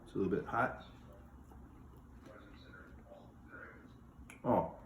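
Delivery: talking while chewing bacon; words around a hot bite; mouth full. Real, not acted. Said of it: "Don't talk with your mouth full".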